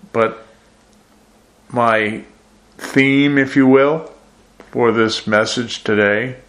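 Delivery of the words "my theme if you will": normal talking voice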